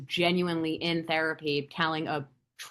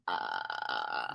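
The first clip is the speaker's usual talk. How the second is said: deep creaky vocal fry grunt; fry the voice all the way down; 9 dB quieter than usual. Shown as a demonstration. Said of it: "loud vocal fry on command?".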